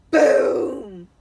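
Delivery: a huge, loud BOOM like a door slammed; booming shout. A narrator's reading. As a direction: shout it out to shake the room. Sound effect of the door bang.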